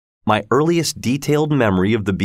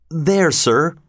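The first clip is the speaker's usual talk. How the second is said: put-on higher, small child's answer; timid boy; 6 semitones higher than usual. Pip answering the convict, high and meek.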